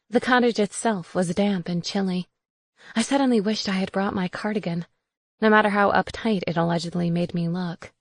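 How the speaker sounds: ordinary delivery, nothing marked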